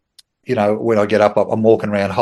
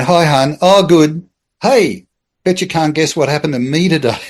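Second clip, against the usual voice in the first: higher, louder, casual phone chat; breezy phone greeting; 5 semitones higher, 4 dB louder than usual. Retold or imitated. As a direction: act out a breezily casual call home. Breezy phone call to pregnant wife.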